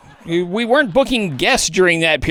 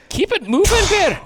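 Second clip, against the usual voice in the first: off-mic handler; 8 semitones higher than usual. Acted. Puppeteer prodding the bear.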